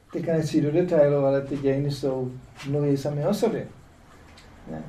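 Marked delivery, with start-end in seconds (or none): none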